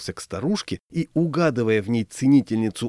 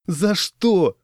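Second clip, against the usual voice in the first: put-on high, plaintive drunken whine; lightly acted tearful complaint; mock sob; 8 semitones higher, 4 dB louder than usual. A narrator's reading. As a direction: wail it as a hurt drunk. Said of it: "всхлипнул дядька".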